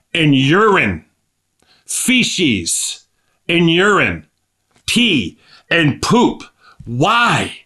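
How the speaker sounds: drawn-out words, high pitch falling on each; incredulous emphasis. Real, not acted. Preacher marvelling that his king was born among dung, incredulous. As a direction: hammer each word apart in pained disbelief. Preacher dwells on filth in disbelief.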